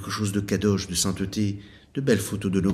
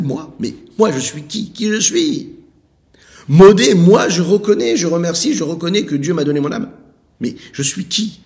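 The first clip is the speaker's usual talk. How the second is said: louder, much higher fervent preaching; impassioned retelling; 8 semitones higher, 9 dB louder than usual. Retold or imitated. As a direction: pour yourself into each question. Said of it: "Il a dit".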